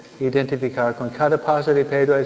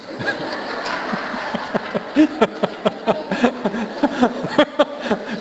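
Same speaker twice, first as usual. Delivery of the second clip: breathy laugh; 8 semitones higher than usual. Real, not acted. Laughs at own joke.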